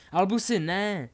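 loud, high warning cry; alarmed shout. Acted. Harry shouts a warning to his son, alarmed. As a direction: shout to stop him. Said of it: "zakřičel na něj Harry".

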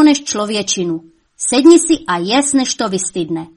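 high, loud scolding; scolding mother. A narrator's reading. Mother scolding her son for the mess, loud and high. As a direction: give the devil son a sharp telling-off.

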